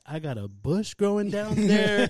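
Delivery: coworker's crude confession, drawn out and hammed up; hammed-up older lady. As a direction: play the lady's shameless overshare, drawn out. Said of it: "she's like".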